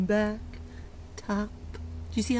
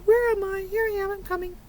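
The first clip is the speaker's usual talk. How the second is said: late bird; 7 semitones higher, much faster than usual. Acted. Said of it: "one was late".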